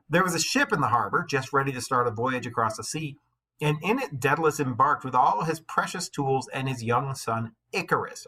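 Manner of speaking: lightly lifted read-aloud voice; steady storybook reading; story narration. A narrator's reading. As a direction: read the myth to the class. Reads the myth aloud.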